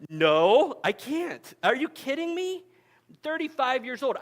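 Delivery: high, loud, incredulous protest; shocked disbelief. Retold or imitated. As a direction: blurt it out, stunned at the offer. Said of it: "I'm like".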